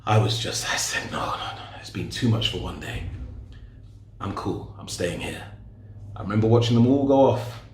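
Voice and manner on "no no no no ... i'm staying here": overwhelmed refusal. Retold or imitated. Overwhelmed, declining the mosque.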